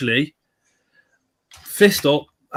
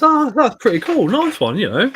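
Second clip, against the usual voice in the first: high, breezy fake enthusiasm; lightly hammed-up upbeat reply, pitched high; mock cheerful; 10 semitones higher than usual. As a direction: sound pleased to keep nan calm. Feigning approval to his nan.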